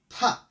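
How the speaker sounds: loud contemptuous puff; scornful pah. A narrator's reading. Thomas brushing off Toby's fear with a scornful pah. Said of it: "boasted Thomas".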